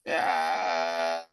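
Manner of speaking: long, low hesitant drone; dumbfounded drone. Retold or imitated. Scott unsure whom to believe, comically stumped. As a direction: hold a low, stuck hesitation, hammed-up.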